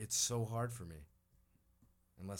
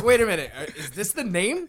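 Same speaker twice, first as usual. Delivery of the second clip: quoted through laughter, pitched higher and louder; laughing; 9 semitones higher, 12 dB louder than usual. Retold or imitated. Retelling his confusion at the sign, laughing. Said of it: "That's why I'm like".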